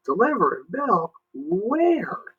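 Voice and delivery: put-on high, confused small-boy question; puzzled boy. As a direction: ask baffled. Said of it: "Jimmy asked".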